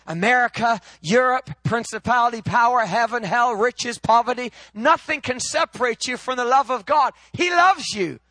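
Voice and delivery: loud, high, ringing pulpit delivery; fired-up preacher proclaiming God's love; full-force preaching. Acted. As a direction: preach it out to the whole room, climbing.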